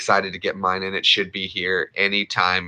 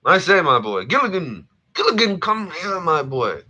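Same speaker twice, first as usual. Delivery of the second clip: Thurston Howell impression; 10 semitones higher than usual. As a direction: summon Gilligan like a pompous millionaire. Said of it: "that's Thurston Howell the third".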